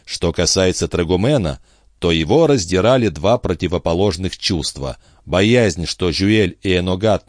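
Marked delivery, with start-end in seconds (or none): none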